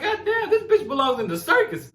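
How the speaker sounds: imitating a friend's high amazed shriek. Retelling friend's amazed reaction.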